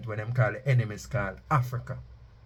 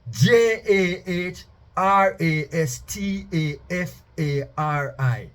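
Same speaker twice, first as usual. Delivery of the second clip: each letter spoken slowly and raised; slow spelled-out reading; 6 semitones higher, 5 dB louder, slower than usual. Acted. Spells out the name.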